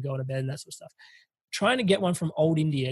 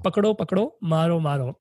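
retold yell; 3 dB louder than usual. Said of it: "literally yells".

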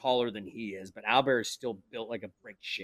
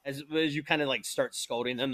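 ordinary delivery

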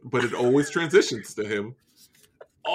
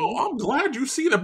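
pleased Southern cartoon bluster; hammed-up Southern voice; Southern gentleman; 12 semitones higher than usual. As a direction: crow it like a Southern rooster. Keeps up Foghorn voice.

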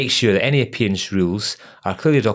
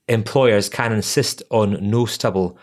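ordinary talk, nothing marked

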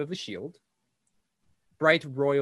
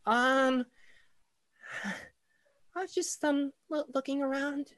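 put-on shy little girl; 13 semitones higher than usual. Shy girl caught by friends.

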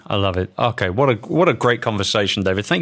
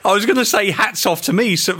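laughing; 11 semitones higher than usual. Real, not acted. Host laughing at own joke.

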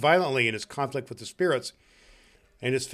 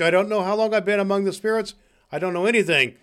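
high, loud excited tumble; giddy bewilderment; 6 semitones higher, 6 dB louder, faster than usual. Acted. Scrooge back from the spirits, giddily bewildered. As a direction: blurt it out, happily lost.

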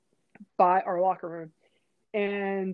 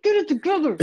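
high, loud, squeezed reprimand; retold frantic scold; mock scolding; 9 semitones higher, 5 dB louder than usual. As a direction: tell off embarrassing friends urgently. Scolding teammates, acted.

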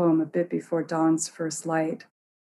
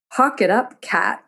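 Odin's order; 4 semitones higher, 4 dB louder than usual. Acted. Odin pokes the cat.

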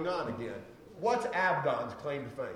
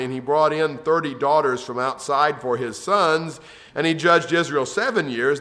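louder, measured reading of the Bible text; scripture reading; 9 dB louder than usual. Acted. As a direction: read the verses out to the congregation. Reads Judges passage aloud.

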